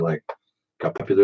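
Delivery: ordinary talk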